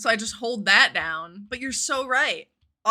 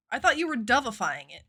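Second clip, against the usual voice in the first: usual speaking voice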